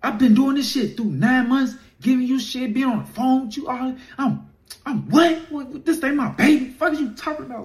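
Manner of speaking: high, loud shocked yelling; shocked outburst. Retold or imitated. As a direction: blow up in disbelief. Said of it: "I'm like".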